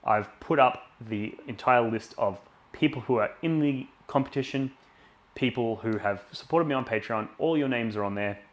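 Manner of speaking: loud, pumped-up stream announcer; hyped giveaway announcement. Acted. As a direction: sell the prize draw with energy. Louder run as he announces the patron draw.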